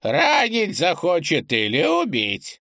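put-on high, slow voice of a strange old man; old eccentric voice. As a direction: play the odd old man, drawn out.

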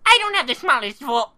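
a very high, loud outraged What; indignant shout. Acted. Professor insulted about his small voice, shouting indignantly. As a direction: explode at the insult.

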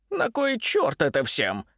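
lightly voiced stubborn crone; slow, a touch of scorn; grumpy old woman. A narrator's reading. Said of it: "Не унималась старуха".

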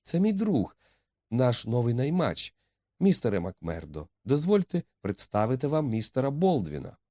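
high-pitched, polite introduction; anxious young woman. A narrator's reading. Etti introduces the two rivals, high and anxious. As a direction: voice a heroine trying to keep things civil.

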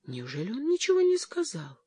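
high-pitched stunned question; shaken. A narrator's reading. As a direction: ask in disbelief, voice jumping up high. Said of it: "спросила потрясённая Джоан".

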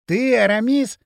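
ordinary delivery, nothing marked

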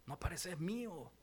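soft, slow parent's coo; lightly voiced proud parent; mock doting parent. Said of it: "ustedes los agarran y dicen".

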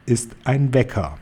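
measured reveal with a touch of triumph; triumphant. A narrator's reading. Head witch revealing her secret, understated triumph. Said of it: "Großmeisterhexe triumphierend".

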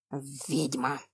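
low hissing whisper; hissed under breath. A narrator's reading. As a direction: hiss the word out low and quiet. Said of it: "прошипел Ютис снова".

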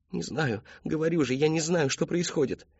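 put-on high, insistent young voice; desperate daughter. A narrator's reading. Daughter insisting to her father, high desperate voice.

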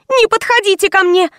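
high-pitched, frightened squeal without much volume. A narrator's reading. Frightened girl warding off her visitor, high squeal. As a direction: squeal it high, keeping someone back. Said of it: "взвизгнула дурочка".

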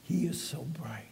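hushed, breathy whisper. Real, not acted. Preacher whispers to the congregation about God's brightness, hushed. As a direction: whisper it in awe. Aside during a sermon.

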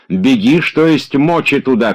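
high, loud calls after someone leaving; shouting after her. A narrator's reading. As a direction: yell after the running maid. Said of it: "кричал он ей вслед".

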